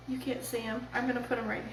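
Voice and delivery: ordinary delivery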